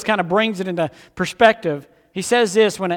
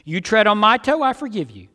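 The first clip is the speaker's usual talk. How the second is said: normal talking voice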